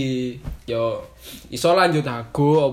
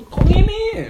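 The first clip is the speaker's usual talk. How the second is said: high, loud surprised yelp; 6 dB louder than usual. Real, not acted. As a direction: yelp it out in disbelief. Surprised exclamation.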